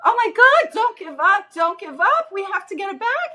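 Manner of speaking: high, frantic pleading, imitating the panicked dentist; frantic crying plea. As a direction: mimic a panicked man begging the team not to quit. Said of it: "he was screaming, crying".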